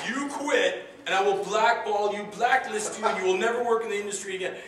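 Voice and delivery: loud threatening shout, pitch way up; angry threat. Retold or imitated. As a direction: yell the threat like a furious producer. Producer threatening, yelling.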